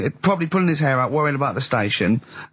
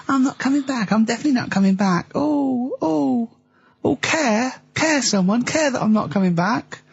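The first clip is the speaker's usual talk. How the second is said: mocking whiny Carl; 8 semitones higher than usual. Mocking Carl's quitting speech.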